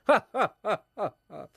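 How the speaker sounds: loud, high stagey ha-ha laugh; mock laugh. Club man after his own joke, hammed-up laugh. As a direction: laugh heartily in the old poet's character.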